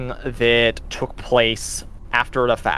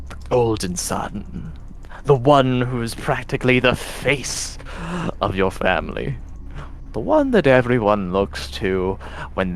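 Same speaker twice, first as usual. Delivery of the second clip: taunting tempter voice; slower than usual. Acted. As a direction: needle him like a voice inside his head.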